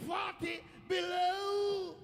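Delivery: high bellow, BELOW hugely drawn out; stretched shocked shout. Retold or imitated. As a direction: shout it very slowly, holding the last word. Repeats the shock, stretched further.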